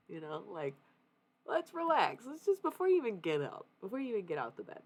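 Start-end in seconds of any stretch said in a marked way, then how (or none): 1.5-4.8 high, hurried self-coaxing; talking oneself down. Acted. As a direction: start high and loud, then settle as you urge restraint.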